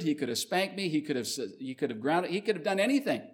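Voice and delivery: ordinary talk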